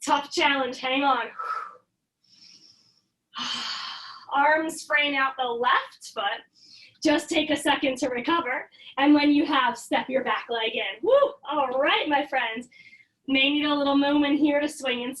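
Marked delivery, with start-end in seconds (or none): 3.3-4.3 soft breathy exhale of effort; unvoiced sigh after a hard hold; tired sigh. Real, not acted. Recovering after tough lunge. 11.0-11.3 high, loud cheer of relief; a real burst of yoga class cheer; excited woo. Real, not acted. Cheering after hard set.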